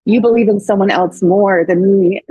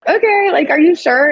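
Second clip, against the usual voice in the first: hesitant high okay; 9 semitones higher than usual. Retold or imitated. Hesitant acceptance, retold.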